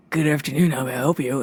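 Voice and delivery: low, cough-roughened phone greeting; deep man's voice. Retold or imitated. Worker answers unknown caller after coughing, deep voice. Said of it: "up with this voice".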